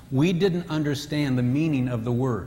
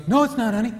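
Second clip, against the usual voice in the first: lightly voiced husband, higher and reassuring; 8 semitones higher, 3 dB louder, faster than usual. Husband reassuring his wife about the meal, lightly voiced. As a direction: reassure her, a touch higher in pitch. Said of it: "He goes".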